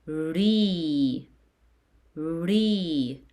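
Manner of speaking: "ri" as a drawn-out ree; "ri" stretched slowly for repeating; long E syllable. Shown as a demonstration. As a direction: hold the ee in "ri", arching then falling. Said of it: "you pronounce it as".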